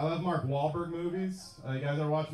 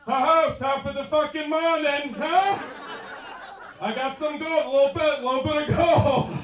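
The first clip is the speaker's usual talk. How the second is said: high-pitched, hammed-up Irish-guy routine; mock Italian-American playing a leprechaun; De Niro; 8 semitones higher, 3 dB louder than usual. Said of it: "De Niro goes ahead like".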